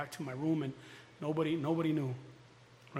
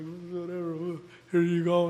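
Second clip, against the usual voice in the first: mock slick; 6 dB louder than usual. Acting slick toward counselor.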